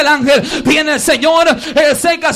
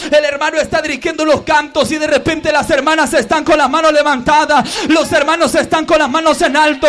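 ordinary talk, nothing marked

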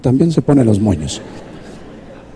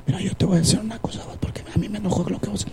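softer, fast hushed scolding; hushed argument; 3 semitones higher, 11 dB quieter, faster than usual. Acted. Husband to wife in bed while children sleep, hushed fast argument. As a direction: argue under your breath so the kids don't wake. Couple arguing in bed, kids asleep.

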